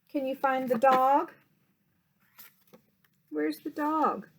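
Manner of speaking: ordinary delivery, nothing marked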